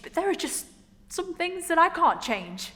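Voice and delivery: loud, high impassioned protest; acted outburst, defending who you are; very emotional. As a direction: plead your case at full voice. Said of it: "very emotional because she hasn't come out".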